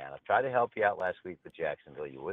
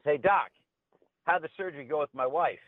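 higher, louder cheery caller; chipper husband; 9 semitones higher, 5 dB louder than usual. Acted. Husband calling his wife's doctor, cheery and higher. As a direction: greet the doctor brightly, like nothing happened.

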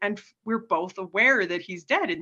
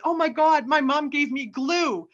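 retelling with mock horror; shocked realization; 7 semitones higher than usual. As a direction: realize in horror what mom did. Realizing the gel is glue.

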